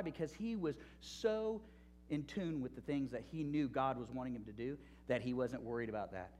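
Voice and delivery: normal talking voice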